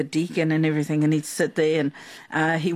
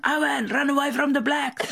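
raised, higher mock shout across a field; retold yell; 6 semitones higher than usual. Mum yelling to her grandson at soccer, mock yell. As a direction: call it out like a mum cheering from the sideline. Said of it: "yelled at him".

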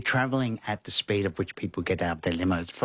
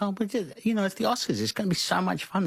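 much higher, bubbly and fast; giddy excitement; 12 semitones higher, faster than usual. Retold or imitated. Retelling how he and Lizzie felt, high giddy gush. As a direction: gush about the fun like a kid. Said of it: "we're like".

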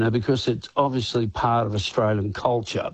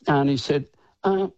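ordinary talk

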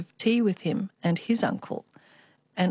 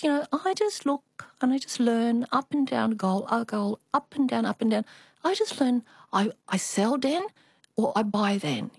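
put-on higher, half-whispered Chinese-accented mum; 4 semitones higher than usual. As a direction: mum explaining gold trading in a light Chinese accent. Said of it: "I always have to do the Chinese accent".

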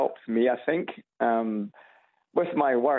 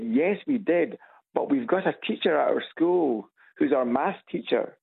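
lightly imitated girls, pitched higher; schoolgirls' voices; 6 semitones higher than usual. Caller retells girls discussing their Scottish maths teacher, lightly imitated schoolgirls. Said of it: "The girls came up to me and said".

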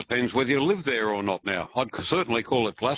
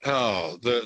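usual speaking voice

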